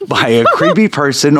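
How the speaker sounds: ordinary delivery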